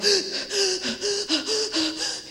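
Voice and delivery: a big high gasp of someone too upset to talk; sharp upset gasp. Retold or imitated. Teacher showing the class how an upset child gasps, sharp gasp. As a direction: gasp like a child fighting tears. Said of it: "You go".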